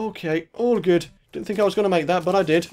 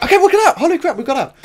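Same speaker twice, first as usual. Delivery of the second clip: relieved; 7 semitones higher, 5 dB louder, faster than usual. Real, not acted. Escaped the trap, relieved.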